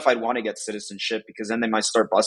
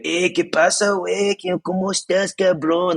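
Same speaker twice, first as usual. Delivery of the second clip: put-on much higher, teasing Mexico City accent; exaggerated Chilango accent; 9 semitones higher than usual. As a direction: roast someone with an overblown Chilango accent. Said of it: "Like, they exaggerate".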